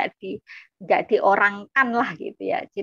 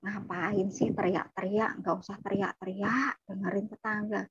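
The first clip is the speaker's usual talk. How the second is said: calm hushing; 8 dB quieter than usual. Retold or imitated. Younger sibling hushes older sibling indoors, soft and unhurried. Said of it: "santai gitu".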